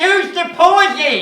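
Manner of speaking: high, loud and slow exasperated shout. Real, not acted. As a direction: yell it at the actors mid-scene. Writer shouts at actors.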